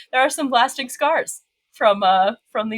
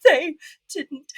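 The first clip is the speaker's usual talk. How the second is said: retold panic, pitched higher and higher; distraught caller; 9 semitones higher, slower than usual. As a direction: pitch climbing high, voice breaking into disbelief. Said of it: "and I'm like".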